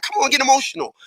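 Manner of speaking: high-pitched coaxing dare; egging on. Acted. Mad daring emotion, high egging voice. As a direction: urge them on, pitched high. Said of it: "mad be like".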